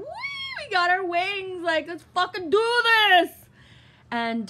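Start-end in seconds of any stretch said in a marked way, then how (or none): 0.0-3.3 high, loud, stretched-out cheer; pumped and gleeful. Retold or imitated. Cheering at the pilots about getting wings, high and loud. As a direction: cheer like you just earned your wings. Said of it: "And I'm, like, just like".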